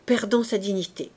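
usual speaking voice

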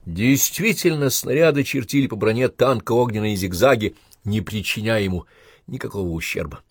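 ordinary delivery

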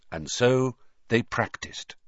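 plain reading voice

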